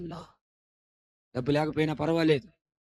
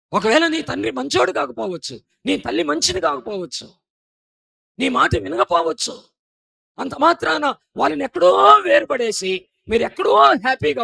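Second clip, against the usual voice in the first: much higher, louder shouted preaching; shouting preacher; 13 semitones higher, 14 dB louder than usual. Acted. Preacher rebuking children who abandon parents, shouted preaching. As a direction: thunder it at the congregation.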